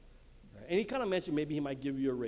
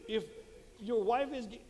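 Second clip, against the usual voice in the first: ordinary talk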